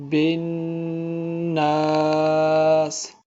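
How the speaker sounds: الناس chanted slowly with a held nasal نّ; tajweed recitation. Shown as a demonstration. Teacher showing ghunna to students, slow chant. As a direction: draw out الناس with ghunna on the نون. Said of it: "موٹا کر کے پڑھیں گے".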